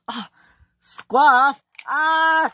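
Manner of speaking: high, loud scream; scream of fright. Acted. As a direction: scream as you fall. Scruff falling into water.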